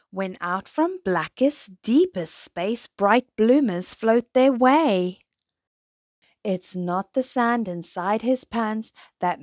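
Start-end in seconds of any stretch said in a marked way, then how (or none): none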